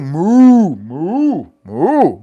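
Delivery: low drawn-out moos, mock cow; cow mooing. Mimicking cows in a moo battle, mock mooing. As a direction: moo like a bull squaring off. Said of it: "them motherfuckers is moo battling".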